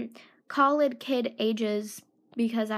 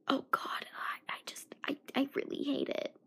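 soft unvoiced whisper of disgust; 10 dB quieter, faster than usual. Real, not acted. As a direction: hush it, sick of it.

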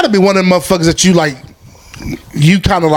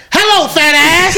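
high, slow mocking greeting; Buddy Love voice; 10 semitones higher, slower than usual. Retelling the Nutty Professor scene, high slow mock voice. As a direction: drawl it out as the movie character. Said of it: "he's like".

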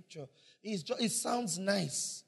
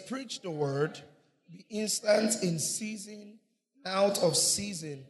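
slow, solemn reading of scripture; 5 dB louder, slower than usual. Acted. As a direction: read the verse out slowly and firmly. Reading scripture aloud together.

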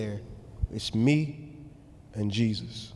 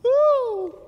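loud, high laughing ooh; squealing ooh of laughter; high whooping laugh; 7 dB louder than usual. Real, not acted. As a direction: let a real laugh burst out high and loud. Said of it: "that's dangerous".

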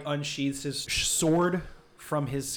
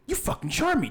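high-pitched, outraged accusation; indignant accusation; 13 semitones higher than usual. Acted. Clyde accusing Jameson of charming him, high and indignant. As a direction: accuse them, voice leaping up.

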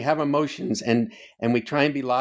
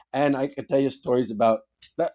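ordinary talk

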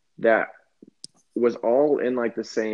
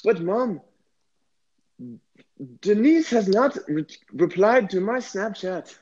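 put-on whiny teen, pitch raised; angsty teen T'Challa; 8 semitones higher than usual. Teen T'Challa whining to his mom about Snapchat. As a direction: complain like a sulky teenager. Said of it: "my Wakanda angsty teen T'Challa".

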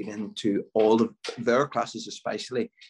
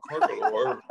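lightly imitated politician's voice; understated impression, close to own voice; Jerry Adams; 5 semitones higher, slower than usual. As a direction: do a mild mimicry of the politician. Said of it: "Jerry Adams".

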